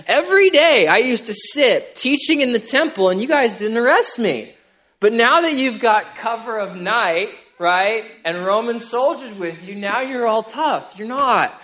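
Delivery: loud, taunting challenge to cowards; mocking the mob. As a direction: call their bluff scornfully. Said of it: "he sort of mocks the mob".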